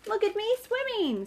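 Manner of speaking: high squeaky little voice; squeaky Roo. A narrator's reading. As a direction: squeak it like a tiny joey. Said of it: "squeaked Roo".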